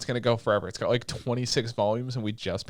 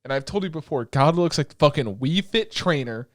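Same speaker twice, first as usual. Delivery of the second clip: ordinary talk, nothing marked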